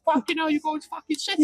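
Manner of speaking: high-pitched mock Cockney; Cockney accent. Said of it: "He said it in a Cockney accent".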